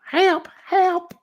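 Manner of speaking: very high mock cry for help; screechy cry. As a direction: squeal for help in falsetto. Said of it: "Jesus, Screecher. Gotta turn my volume down".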